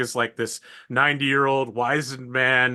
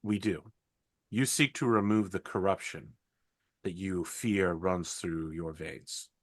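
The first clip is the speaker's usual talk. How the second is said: solemn sister voice; 3 semitones lower, 3 dB quieter than usual. Acted. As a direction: speak low and grave like a holy sister. Sister speaks in the holy place.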